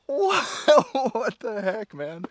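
very high, loud incredulous outburst; hammed-up astonishment, easing off at the end; amazed disbelief. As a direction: react as if blown away. Acting own amazed reaction to the passage.

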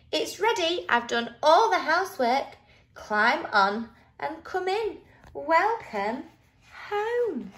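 high-voiced, cheery little creature; welcoming Brush. A narrator's reading. Brush welcoming friends to the new shell, inviting. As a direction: invite them in with a lifted voice.

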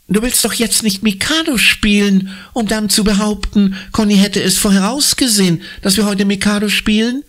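put-on high, disbelieving boy, speeding up at the end; can't believe what he's hearing; incredulous. Billy mocks Anna's plan, incredulous. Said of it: "fragt Billy fassungslos".

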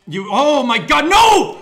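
high, loud scream of despair; anguished scream. Real, not acted. Gamer screaming at a game disaster.

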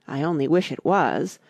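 ordinary delivery

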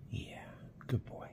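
soft whispered praise for the cat; whisper to pet. Real, not acted. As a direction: breathe it to the pet.

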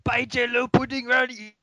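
squeaky hammed-up impersonation; guest impersonation. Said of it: "my new guest impersonation".

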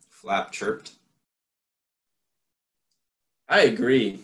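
put-on lively read-aloud voice for children; story narration. A narrator's reading. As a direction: read it like a bedtime picture book. Reads a picture book aloud.

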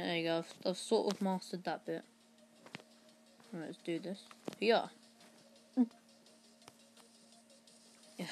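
usual speaking voice

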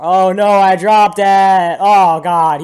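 high, loud, drawn-out wail; mock-panicked shout. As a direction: cry out in exaggerated dismay. Acts out dropped sword mishap.

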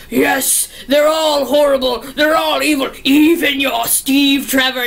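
hammed-up villain, lightly voiced; Ares. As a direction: play the tempting villain, drawing out the last line. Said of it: "trying to be like".

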